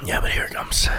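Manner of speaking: ominous low whisper. Real, not acted. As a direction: whisper a warning. Warning about hot sauce coming.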